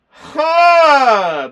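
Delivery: loud, high hammed-up gasp; big fake shocked gasp; mock gasp. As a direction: gasp as if the twist just hit. Mock gasp at plot twist.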